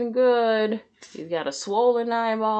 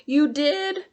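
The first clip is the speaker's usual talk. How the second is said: surprised; 8 semitones higher, 3 dB louder than usual. A narrator's reading. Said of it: "asked SpongeBob".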